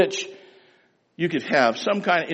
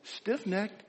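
pleading; 4 semitones higher, 6 dB quieter, slower than usual. Retold or imitated. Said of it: "pleading his case".

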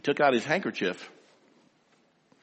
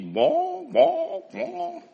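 mock baby wail; 14 semitones higher, much slower than usual. People whining to God.

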